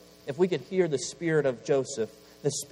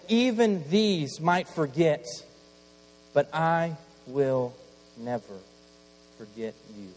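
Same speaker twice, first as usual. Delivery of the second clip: tender promise; 2 semitones higher, slower than usual. Acted. God promises the boy he won't forget, slow tender fade. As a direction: let God's promise sink slowly into softness.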